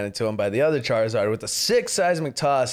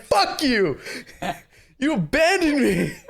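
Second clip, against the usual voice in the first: higher, laughing angry yell; mock outrage; 10 semitones higher than usual. Psyduck raging at Misty for abandoning him, higher mock yell. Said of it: "And Psyduck's like".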